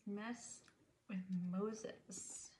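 hushed whisper of the title; librarian shushing whisper. Real, not acted. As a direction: breathe the words without voice. Reads book title, then repeats.

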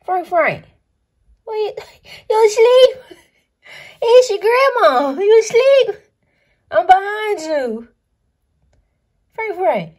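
high, loud sing-song calling of the pet; doting grandma call. Real, not acted. Doting grandma calling her pet Frank, high and loud. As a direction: coo at him loudly, way up high, to get him to come.

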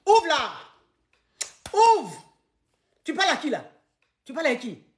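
high, loud shouted demands; violent jealous husband. Acted. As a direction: bellow at the bathroom door. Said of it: "Pourquoi tu es violent comme ça?".

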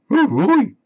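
high, loud cartoon dog whoop; a goofy dog's eager hoot; Scooby-Doo agreeing to the trap; Scooby cheer. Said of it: "said Scooby".

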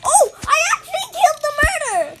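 high, fast, loud laughing shock. Real, not acted. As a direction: blurt it through laughter.

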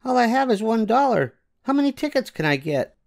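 put-on high girlish voice, quickening question; eager child counting her dollar; little girl. Yvonne asks about tickets.

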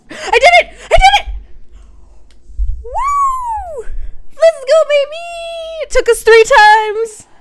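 high, loud victory shouts; ecstatic yelling. Real, not acted. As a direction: scream with triumph. Victory over boss.

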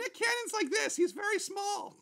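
high put-on diva voice. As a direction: chat up high as the star guest.

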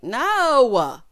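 loud, drawn-out exasperated no; emphatic refusal. Real, not acted. Host rejecting grown-up freshman flirting, emphatic refusal. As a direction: stretch it out loudly in protest.

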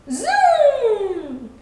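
high, loud, long zoom; drawn-out whizzing noise, sliding down; zoom sound effect. A narrator's reading. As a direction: stretch it out like a beam shooting off. Light suddenly shoots out.

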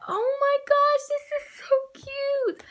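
high delighted gushing; excited squeal. Real, not acted. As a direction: coo over the cute little toy. Reveal of a cute toy.

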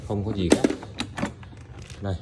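ordinary talk

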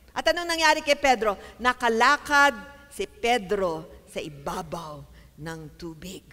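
ordinary delivery

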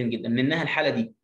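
normal talking voice

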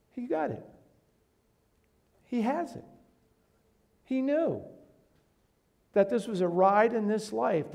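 high, rising bursts of praise; excited. Real, not acted. Preacher praising the college kid's faith, excited and high. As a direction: lift each short phrase with admiration.

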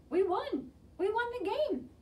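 very high, loud victory cry; excited yell. A narrator's reading. Tom after the winning run, jubilant yell. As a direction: celebrate at the top of the voice. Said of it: "Then Tom yelled".

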